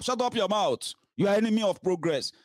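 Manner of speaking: quoting people who silence critics with scorn; mock dismissive retort. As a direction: sneer it like a loyalist shutting a critic down. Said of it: "who tell my ego to shut up".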